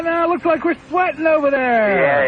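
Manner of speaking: squeaky comic persona needling a guest; falsetto comic character. Acted. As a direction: tease the caller in a silly high voice. Host's put-on character voice.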